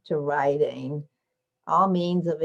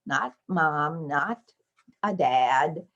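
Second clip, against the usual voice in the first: ordinary talk, nothing marked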